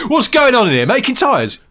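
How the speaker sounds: high, loud amazed questions; over-excited bellowed disbelief; hyperactive shouting. Retold or imitated. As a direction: yell it like an astonished TV presenter. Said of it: "shouting at people".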